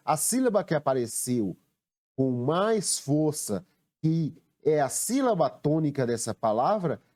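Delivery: ordinary delivery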